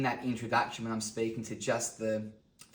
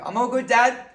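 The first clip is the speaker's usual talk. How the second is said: high, loud forced cheer; acted overly bright call to dad; brave-faced kid; 11 semitones higher, 11 dB louder than usual. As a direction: reassure dad while hiding the pain. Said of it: "I put my thumbs up and I go".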